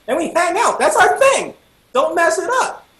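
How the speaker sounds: high, loud mock outrage; hammed-up panicky protest to a buddy; indignant protest. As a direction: plead your case, pitch shooting up. Protesting to friend about their thing.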